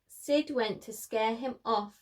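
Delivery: ordinary delivery, nothing marked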